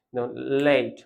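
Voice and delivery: ordinary delivery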